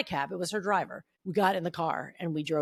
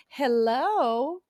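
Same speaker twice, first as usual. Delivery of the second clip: high, drawn-out hello; Blanche; 6 semitones higher, 3 dB louder than usual. Retold or imitated. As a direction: greet like a grand television diva. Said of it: "you remember Blanche from Golden Girls".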